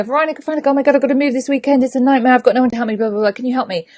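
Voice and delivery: high, rushed, panicky babble; frantic plea. Acted. Friend begging coach for help moving house, frantic. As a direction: gabble a desperate request for help.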